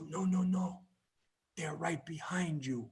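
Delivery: hushed, fearful whisper as grandma; soft, high "no"s sinking to a slow whisper; frightened grandmother. Retold or imitated. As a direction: imitate a scared old woman warning under her breath. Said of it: "with this fear".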